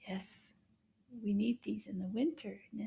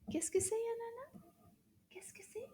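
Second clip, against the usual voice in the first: puppet whisper; 10 semitones higher, 8 dB quieter than usual. Acted. Puppet pineapple whispers a question.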